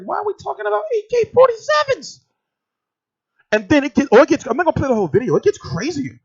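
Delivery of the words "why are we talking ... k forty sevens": much higher, startled question; as if you can't believe where this is going; alarmed disbelief. Retold or imitated. Filming as talk turns to rifles, startled and high. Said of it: "I was like".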